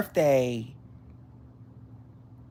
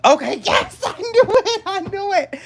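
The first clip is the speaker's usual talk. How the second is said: high, loud, excited gotcha; triumphant squeal; 5 dB louder than usual. Real, not acted. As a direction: crow it, delighted she guessed right. Guessing a viewer's star sign.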